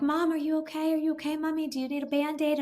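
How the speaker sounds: put-on high, anxious little-kid voice; worried kids. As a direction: play the kids fussing over mom. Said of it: "all the kids are like".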